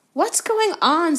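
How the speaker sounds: high, slow suspicious question; frowning demand. A narrator's reading.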